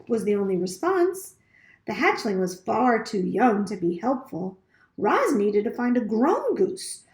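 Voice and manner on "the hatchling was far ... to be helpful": ordinary delivery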